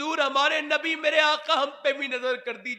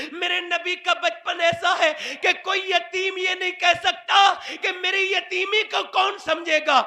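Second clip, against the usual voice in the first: fiery preaching; 5 semitones higher, 5 dB louder than usual. Acted. Preaching at full force.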